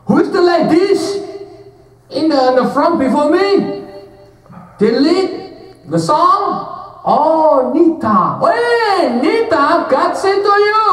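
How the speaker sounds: loud, high English prophecy voice; African prophetess. Retold or imitated. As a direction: call out the prophecy from the pulpit. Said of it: "Ibu Afrika itu, Nabia, naik ke mimbar".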